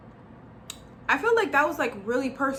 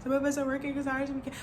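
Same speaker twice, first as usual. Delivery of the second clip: higher, softer, rushed imitation of crying; mock tearful protest; 4 semitones higher, 5 dB quieter, faster than usual. Retelling their hurt reply to the person who angered them, mock crying. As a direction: rush it out like a half-hearted tearful complaint. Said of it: "So I'm, like, crying".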